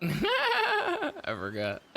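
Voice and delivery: giggling through the words; laughing. Real, not acted. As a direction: laugh it out helplessly. Laughing at own mistake.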